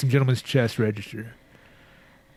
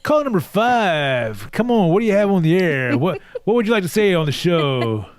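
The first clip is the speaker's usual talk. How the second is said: radio host; 6 semitones higher than usual. Pretend. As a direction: take calls like a hyped radio host. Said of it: "talk host voice".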